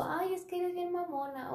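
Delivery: high, slow, whiny put-down; mocking whiny coworker. Imitating a coworker who won't help, in a high, slow whine. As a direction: drag it out like a snotty coworker on her phone.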